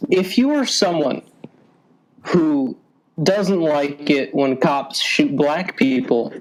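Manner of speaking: ordinary delivery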